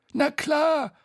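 high, loud, confident character voice; Bo, cocksure. Acted. Bo persuading Tete to go hatless, high and confident. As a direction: boast cheerfully in a high voice.

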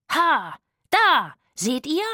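loud, high triumphant shout; calling out. Acted. Rufus brandishes his trombone, shouting loud into darkness. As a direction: crow it into the dark. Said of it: "rief er in die Dunkelheit".

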